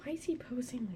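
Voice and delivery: soft unvoiced whisper. Real, not acted. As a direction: breathe the question under breath. Whispered aside.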